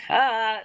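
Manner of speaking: high, drawn "ah"; taunting cry. Retold or imitated. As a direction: jeer at them while passing. Mimics taunting cry while pointing.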